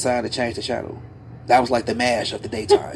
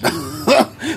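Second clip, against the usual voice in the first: laughing; 4 semitones higher, 3 dB louder than usual. Real, not acted. Laughing at own punchline.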